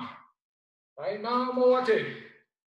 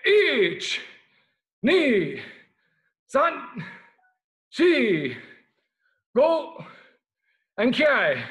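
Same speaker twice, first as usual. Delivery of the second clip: shouted count; 3 semitones higher, 8 dB louder, much slower than usual. Shown as a demonstration. Karate instructor drilling the class, shouting the count. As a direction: bark each count out loud, ending on the kiai. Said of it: "you ready?".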